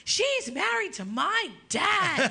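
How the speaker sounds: high, slow put-on child voice; imitating little girl. Daughter telling the old man off, in a high slow little-girl voice. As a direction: play a small girl protecting her mom.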